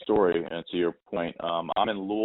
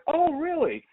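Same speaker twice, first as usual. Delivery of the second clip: a higher, lightly voiced mom sounding impressed; mom's voice; slower than usual. Mom hears he played basketball, lightly voiced high surprise. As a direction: lift the pitch into an interested mom.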